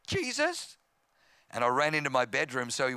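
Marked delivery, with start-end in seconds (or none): 0.1-0.8 very high, small wavering answer; acted near-tears reply, pitched way up; upset squeak. Hurt boy answering his taunting brother, upset squeak.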